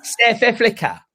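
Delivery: high, hammed-up South African accent; proudly overdone South African vowels; dire South African accent. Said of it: "Dire accent".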